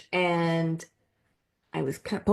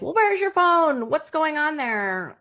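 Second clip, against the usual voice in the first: high, bright fairy voice, slower at the end; frequency fairies; 9 semitones higher than usual. Retold or imitated. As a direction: play a curious little fairy asking. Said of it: "they're like".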